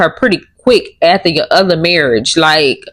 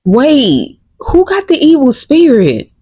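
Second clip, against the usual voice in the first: higher, incredulous double-take; shocked disbelief; 8 semitones higher than usual. Real, not acted. Cohost reacting to the evil spirit accusation, stunned and incredulous. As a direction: blurt it out, stunned by what you just heard.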